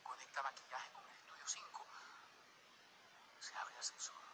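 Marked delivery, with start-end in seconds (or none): 3.4-4.2 awed whisper. Retold or imitated. Awed scene of the star.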